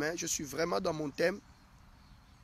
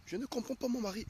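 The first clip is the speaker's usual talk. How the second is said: complaining wife; 6 semitones higher, 4 dB quieter than usual. Acted.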